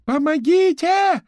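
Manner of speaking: very high, slow, drawn-out scream; cry for help. A narrator's reading. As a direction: yell for help at the top of your voice. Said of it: "Вопль из кухни". (протяжный вопль о помощи)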